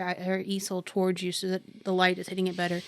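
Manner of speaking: usual speaking voice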